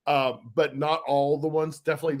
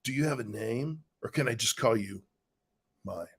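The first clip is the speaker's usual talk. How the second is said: pickup line voice; 7 semitones lower, 7 dB quieter than usual. Pretend. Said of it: "I gotta do it in the voice".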